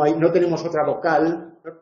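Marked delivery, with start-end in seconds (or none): none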